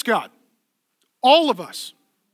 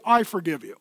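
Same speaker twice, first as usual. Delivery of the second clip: "I" stressed in a low, quieter voice; stress on I, lower; 7 semitones lower, 3 dB quieter than usual. Shown as a demonstration. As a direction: lean on "I", drop the voice.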